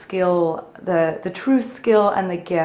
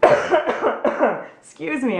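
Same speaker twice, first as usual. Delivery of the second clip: coughing, then a strained apology; coughing fit; 6 semitones higher, much slower than usual. Real, not acted. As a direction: cough it out, then excuse yourself. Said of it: "I'm coughing through this whole video".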